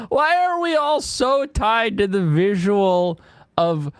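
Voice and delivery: ordinary delivery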